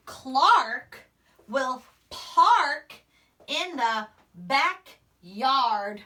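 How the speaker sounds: in the backyard drawn out very slowly; teacher modelling slow reading. Shown as a demonstration. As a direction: read in the backyard word by word, slowly. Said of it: "reading slowly".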